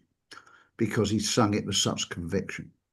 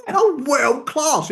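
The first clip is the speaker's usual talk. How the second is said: much higher and very slow; mock rapture; 4 dB louder, much slower than usual. The therapist raving about his next try, hammed-up delight. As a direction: savour the words in self-delight. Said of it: "Next time, go".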